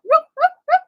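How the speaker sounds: loud high party whoop. Acted. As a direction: whoop it up, hands in the air. Hands up cheer.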